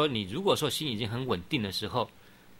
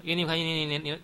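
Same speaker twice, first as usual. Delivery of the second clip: rattling it off faster and faster, a bit higher; speeding up; 7 semitones higher, faster than usual. Shown as a demonstration. Teacher demonstrates the speeding-up recitation, fast and rising. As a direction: show the chant accelerating to its peak. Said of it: "这个叫追顶念".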